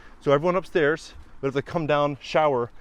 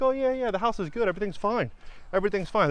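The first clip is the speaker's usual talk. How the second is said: high, breezy yes-man voice, lightly imitated; mock reassurance; 6 semitones higher than usual. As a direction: brush off every worry like a smooth inspector. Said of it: "They'll be like".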